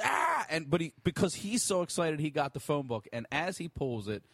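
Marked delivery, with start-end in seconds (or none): 0.0-0.4 short, high mock scream; imitated scream. Friend retelling the phone book ripper's cry, imitated scream. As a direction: yelp it high, as an excited retelling. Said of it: "he screams".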